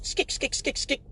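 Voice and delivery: mock running footsteps sound; imitated shoe squeak. Comic mimics gator shoes sprinting, skick skick. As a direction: click out the shoe steps lightly. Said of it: "dress shoes running".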